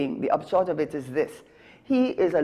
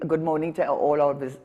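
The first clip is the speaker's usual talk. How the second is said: usual speaking voice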